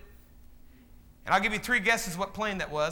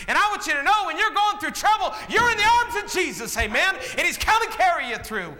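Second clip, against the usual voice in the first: preaching at full force; 6 semitones higher than usual. Real, not acted. Preaching climax.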